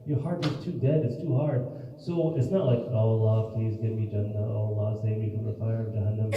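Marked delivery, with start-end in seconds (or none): 2.9-6.3 low, dry monotone prayer. Shown as a demonstration. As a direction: recite the plea with no feeling at all. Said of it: "Uh, very dry".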